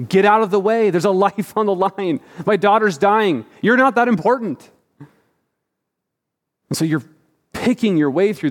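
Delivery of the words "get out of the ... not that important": raised, higher and louder frantic yell; inner scream. Retold or imitated. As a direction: push through the crowd, yelling inside. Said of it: "you're screaming at every single person".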